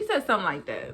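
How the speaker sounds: ordinary talk, nothing marked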